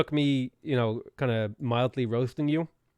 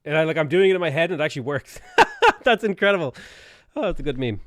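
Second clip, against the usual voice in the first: loud, higher, breaking into laughter; delighted laughing; 4 semitones higher, 8 dB louder than usual. Real, not acted. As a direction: marvel and crack up. Delighted that accent trick works.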